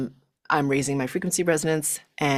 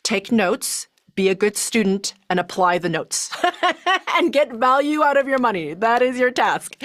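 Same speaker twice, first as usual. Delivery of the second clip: lecturing grandmothers; 6 semitones higher than usual. Retold or imitated. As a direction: play the dutiful Chinese grandmothers scolding her, half-laughing. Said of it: "all my grandmothers are like".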